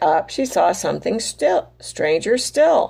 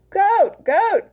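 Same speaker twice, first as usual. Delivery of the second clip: calling out; 10 semitones higher than usual. Acted. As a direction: cry out to Goat. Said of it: "cried Hen".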